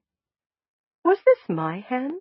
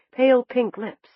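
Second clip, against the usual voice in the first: ordinary delivery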